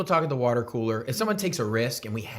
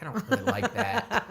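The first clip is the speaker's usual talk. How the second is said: mock critic; 10 semitones higher than usual. Imitating self criticizing.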